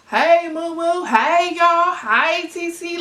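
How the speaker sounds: cousin's high, loud Southern drawl; imitated Southern accent. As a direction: call out greetings in a drawn-out country accent. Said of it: "I especially love hearing my cousin ... speak when I go down south".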